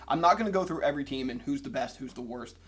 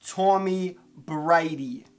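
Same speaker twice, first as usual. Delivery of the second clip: put-on deliberately hammy Boston accent; clumsy mock-Boston twang; bad Boston accent; 3 semitones higher, 5 dB louder, much slower than usual. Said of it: "That was the worst Boston accent".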